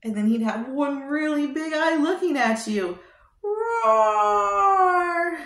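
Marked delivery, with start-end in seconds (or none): 3.4-5.4 big playful monster roar; dino roar. Pretend. Roaring as a dinosaur for a child.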